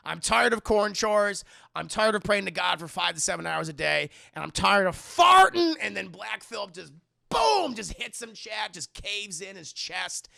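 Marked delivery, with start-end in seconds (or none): 0.1-5.7 hammed-up exasperation, shouting the last word; mock fed-up rant. Retold dad gives up before dying, in a mounting mock rant. 7.3-7.7 high, loud burst; imitated impact. Imitates the goat's fatal blow.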